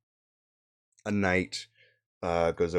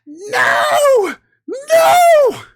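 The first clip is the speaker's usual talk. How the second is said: anguished high shout of no; screaming no; 17 dB louder than usual. Acted. Accusers crying out in despair. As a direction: cry out in despair. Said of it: "you hear them scream out".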